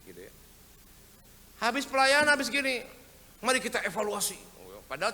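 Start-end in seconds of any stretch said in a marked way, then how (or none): 1.6-4.4 high, loud fed-up complaint; hammed-up grumbling; mock exasperation. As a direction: vent about the endless evaluations. Griping about post-service critique.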